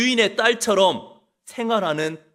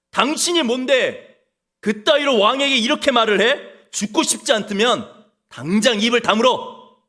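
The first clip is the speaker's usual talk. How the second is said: higher, louder furious retort; pretend angry king; 4 semitones higher, 4 dB louder than usual. King lashes out at the prophet, mock furious. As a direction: snap back like an insulted king. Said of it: "이렇게 화내지 않았습니다".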